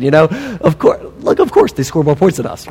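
ordinary delivery

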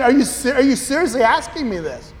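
stunned at the question, thinking it; incredulous inner voice. Retold or imitated. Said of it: "I'm just sitting there thinking".

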